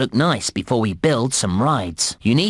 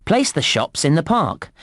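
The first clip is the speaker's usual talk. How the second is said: usual speaking voice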